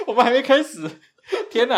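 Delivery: high, loud, laughing protest; giggling protest. Real, not acted. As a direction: say it while still laughing.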